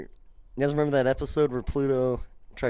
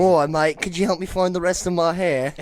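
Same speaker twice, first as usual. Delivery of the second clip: hammed-up English caller, pitched up; English accent; 4 semitones higher, 4 dB louder than usual. Friend riffs as an English actor, exaggerated English accent. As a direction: plead for help in a mock English accent. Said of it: "you do an English accent".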